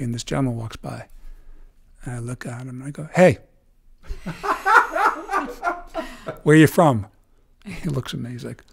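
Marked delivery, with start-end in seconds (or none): 3.1-7.1 laughing call to a stranger; giggling greeting; laughing hey. Retold or imitated. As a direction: giggle through the hello.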